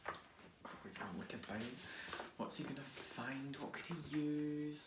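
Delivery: soft, whispered wondering; quiet curious hush; hushed suspense. Acted. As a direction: draw the kids in quietly.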